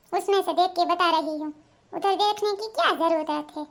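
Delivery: ordinary talk, nothing marked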